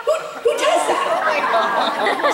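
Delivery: high, laughing, drawn-out question; laughing disbelief. Real, not acted.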